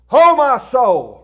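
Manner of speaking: loud, slow, drawn-out exclamation; loud dismay. Retold or imitated. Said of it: "I said".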